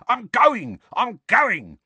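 high, loud, hasty surrender; cowering. A narrator's reading. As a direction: give in while ducking blows.

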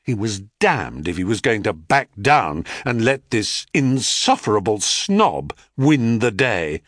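usual speaking voice

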